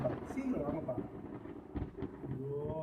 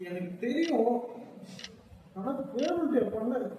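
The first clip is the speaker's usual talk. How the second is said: pleading; 10 semitones higher than usual. Acted. Guilty brother pleading.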